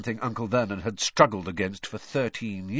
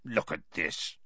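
low, softer growl from an angry uncle; 5 semitones lower, 6 dB quieter than usual. A narrator's reading. Uncle showing Harry the stamped envelope, growling. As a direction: growl it low, with contained anger. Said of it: "he growled".

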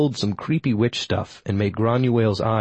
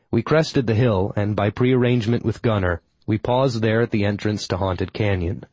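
plain reading voice

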